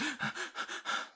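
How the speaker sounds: sharp high breathless gasp; panicked gasp. Acted. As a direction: gasp in terror for air. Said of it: "she's hyperventilating now".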